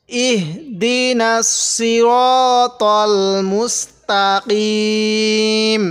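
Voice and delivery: very slow Quran recitation, long held vowels; joined recitation. Shown as a demonstration. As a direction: recite it as one connected, sustained verse. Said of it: "এবার আমরা মিলিয়ে পড়ব".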